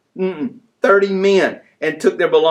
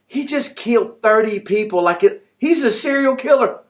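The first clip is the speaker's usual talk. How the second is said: startled disbelief at what he just read; shocked realization; 6 semitones higher than usual. Retold or imitated. Preacher recalling his shock reading the verse, higher and alarmed. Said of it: "but I thought".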